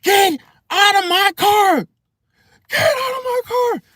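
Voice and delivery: high, drawn-out imitation scream; slow, piercingly high mock scream. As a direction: scream it like the driver in a viral video. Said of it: "he was, like, screaming".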